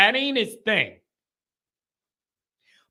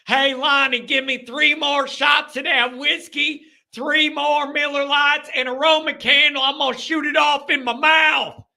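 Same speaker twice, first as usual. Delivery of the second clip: rowdy drinker; 7 semitones higher than usual. Acted. Drinker hollers to Lonnie for shots and a Roman candle, rowdy. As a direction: holler for more shots like a reckless partier. Acting a drunk fireworks daredevil.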